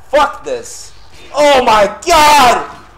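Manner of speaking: high, very loud outburst; real exasperated scream; furious yelling. Real, not acted. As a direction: shout it at full force, drawing out the end. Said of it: "I'm sorry for being so loud".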